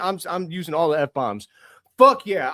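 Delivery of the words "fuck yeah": louder burst of joy; real celebratory yell; excited yell. Real, not acted. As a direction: punch it out loud. Fan celebrating draft lottery win.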